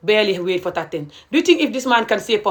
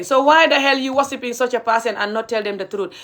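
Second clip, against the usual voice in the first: ordinary talk, nothing marked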